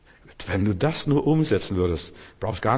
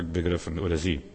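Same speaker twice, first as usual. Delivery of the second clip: ordinary delivery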